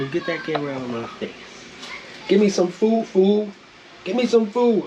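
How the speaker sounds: high whiny cat voice begging; voicing the cat. Speaking for the hungry cat, high whiny voice. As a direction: beg for food like the cat, high.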